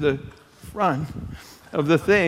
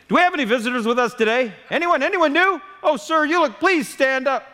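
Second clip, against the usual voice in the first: higher, fast, overly eager church greeter; chirpy greeter; 8 semitones higher, faster than usual. Retold or imitated. Mocking a church greeter calling on visitors, bright and fast. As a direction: sing out a welcome to visitors.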